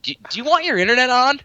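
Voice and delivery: high, fast mock-menacing voice; mock threat. Teasing a friend with a pretend threat, high and fast. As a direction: squeak the threat like a cartoon thug.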